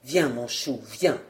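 low, soft coaxing; soft, low endearment; very gentle. Acted. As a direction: coax your wife along tenderly. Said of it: "doucement, très doucement, il lui dit".

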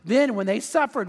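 ordinary delivery

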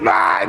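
high acted cry of exasperation; short, high-pitched angry "ah"; mock enraged yelp; mad yell. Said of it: "So she gets mad".

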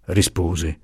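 ordinary delivery, nothing marked

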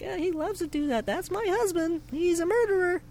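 very high, soft, cheery mock wife; sweet wife voice. Friend imitating the wife's cute joke, very high sweet voice. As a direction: brag sweetly about a killer husband. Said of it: "She's like".

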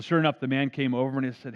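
usual speaking voice

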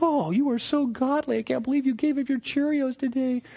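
sarcastic praise for a fasting friend; mock admirer. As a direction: coo with fake awe. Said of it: "It's like".

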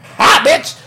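high, loud triumphant shout; gloating pounce. Acted. Player netting a bug, triumphant shout. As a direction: pounce on it with a sharp high yell.

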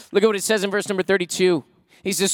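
normal talking voice

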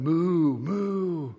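put-on a light cow moo for the toddler. Parents to their daughter, playful mock cow moo. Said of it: "we've been going".

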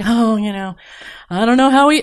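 mimicking a wife complaining about her husband; mocking exasperated wife. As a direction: send up the put-upon wife who knows best. Mocking wives belittling husbands.

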